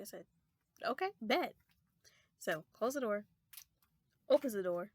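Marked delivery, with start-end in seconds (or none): 0.8-1.5 defiant. Retold or imitated. Defiant reply to threat.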